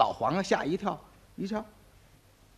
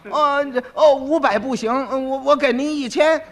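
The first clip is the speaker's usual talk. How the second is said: hurried, nervous offer, pitch higher; anxious bribe; 6 semitones higher, 5 dB louder than usual. Acted. (崔英慌忙加价，声调发紧)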